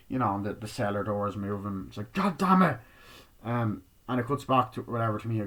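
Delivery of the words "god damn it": annoyed curse. Retold or imitated. Frustrated at moving cellar door.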